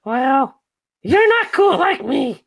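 high, cocky puppet voice; Urakaz puppet voice. Acted. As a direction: brag in a squeaky character voice. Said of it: "Urakaz has something to say".

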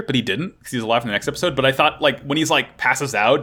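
normal talking voice

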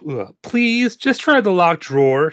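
high, loud, insistent plea; pleading. A narrator's reading. Girl begging to open the locked drawer, pleading. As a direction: beg like a child, pitched right up. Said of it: "she pleads".

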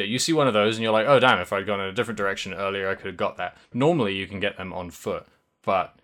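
ordinary delivery

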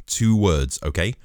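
normal talking voice

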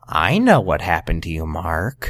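very low, ominous creepy delivery; low, sinister impression of a prying creep; creepy mock voice. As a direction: creep it out deep in the throat. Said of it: "I can feel that in my ears".

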